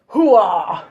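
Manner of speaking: hero's hearty war cry; battle cry. Acted. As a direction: bellow like a warrior.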